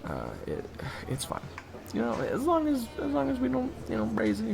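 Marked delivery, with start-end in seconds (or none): 0.1-1.4 sudden low hush. Acted. Drops to whisper again.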